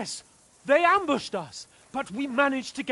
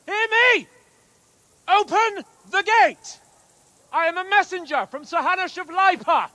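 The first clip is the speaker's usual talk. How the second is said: calling out; 6 semitones higher, 4 dB louder than usual. Acted. Calling up to the closed castle gate.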